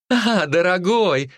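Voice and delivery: high, slow, gushing welcome; delighted. A narrator's reading. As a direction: greet him with sweet delight. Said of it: "обрадовалась та".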